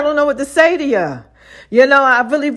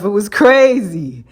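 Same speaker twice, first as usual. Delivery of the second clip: ordinary talk, nothing marked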